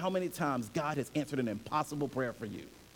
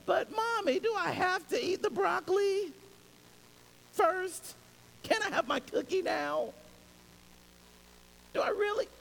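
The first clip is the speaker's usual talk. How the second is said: put-on much higher, whining little girl; whiny child voice; 14 semitones higher than usual. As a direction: whine like a kid pleading over broccoli. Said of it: "the pitch turns up a little bit".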